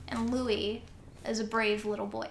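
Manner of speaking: usual speaking voice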